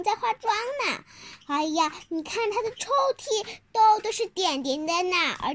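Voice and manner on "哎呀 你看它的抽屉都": ordinary delivery, nothing marked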